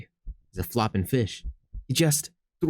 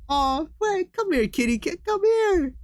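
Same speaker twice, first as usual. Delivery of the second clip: very high cooing voice; slower than usual. Real, not acted. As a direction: coo at a kitten. Calling the cats.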